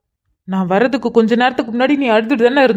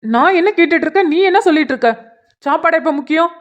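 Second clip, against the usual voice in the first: higher-pitched, irritated challenge without shouting; exasperated reproach; 6 semitones higher than usual. Acted. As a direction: scold her for dodging the question. Brother angered by sister changing subject. (எரிச்சலுடன் கடிந்து கேட்பது)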